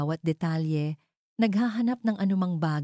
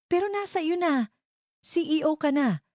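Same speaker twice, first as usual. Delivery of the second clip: higher, frustrated outburst, read aloud; a narrated wife's exasperated yell, pitched higher; shouted; 12 semitones higher than usual. A narrator's reading. Wife shouting at her husband the CEO, higher, exasperated yell. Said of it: "Sigaw ko".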